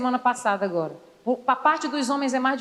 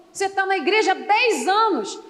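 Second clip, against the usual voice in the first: ordinary delivery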